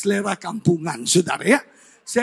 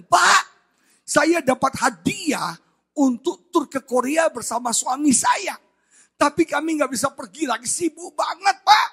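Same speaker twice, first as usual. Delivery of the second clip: raised, eager caller voice; penelepon antusias; 5 semitones higher than usual. Retold or imitated. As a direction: imitate an excited friend on the phone. Acts friend's excited phone call. (suara penelepon bersemangat, nada tinggi)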